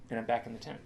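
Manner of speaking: ordinary delivery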